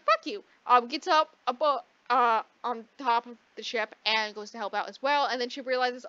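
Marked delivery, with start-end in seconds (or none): 0.0-0.4 squeaky high snappy fuck-off; a high, bratty mock brush-off; mock retort. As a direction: fling it back high and snippy. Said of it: "she's like".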